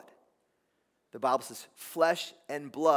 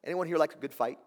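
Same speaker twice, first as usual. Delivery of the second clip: ordinary talk, nothing marked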